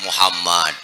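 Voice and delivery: low, soft answer; humble reply. Acted. Prophet answers the sick man at the door, softly.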